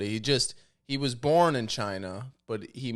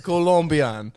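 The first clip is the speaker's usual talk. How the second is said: loud, higher, exaggerated Colombian accent; 5 semitones higher, 10 dB louder than usual. Shown as a demonstration. Host repeating his party trick, loud Colombian accent. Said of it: "I can do a Colombian accent".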